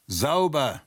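a narrator's contained version of a bellowed word; mild bellow. A narrator's reading. Masked intruder calls out in the dark, mild bellow. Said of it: "jemand brüllte".

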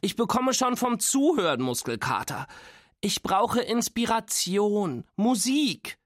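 high-pitched dramatic whining; dramatic complaint. A narrator's reading. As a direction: plead for relief, pitch climbing. Nora keeps complaining about the podcast.

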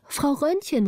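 high call across the room. Acted. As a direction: call her name up high. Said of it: "rief Gritta". (Gritta ruft die alte Frau, hoch gerufen)

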